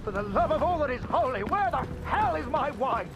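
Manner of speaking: high, rapid outburst; exasperated demand. Acted. Officer explodes over missing wine.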